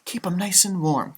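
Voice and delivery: ordinary delivery, nothing marked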